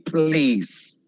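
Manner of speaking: usual speaking voice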